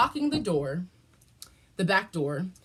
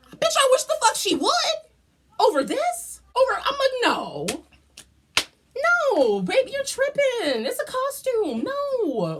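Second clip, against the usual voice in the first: high, loud, sassy brush-off; sassy disbelief; 14 semitones higher, 3 dB louder than usual. Retold or imitated. Retelling her reply to the coworker, sassy and high. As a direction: scoff at the idea, voice way up.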